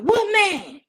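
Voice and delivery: loud, high demand from dad; acted urgent dad. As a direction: fire back an alarmed question as the father. Dad's urgent question, retold.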